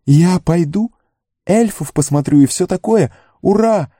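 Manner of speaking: high-pitched, overjoyed cheering; Sam's excited yelling. A narrator's reading. Sam learns he will see the elves, yelling in high excitement. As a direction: cry it out, bursting like a dog before a walk. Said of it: "завопил он".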